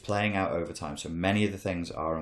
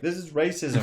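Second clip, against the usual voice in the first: mock accuser; 10 semitones higher than usual. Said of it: "be like".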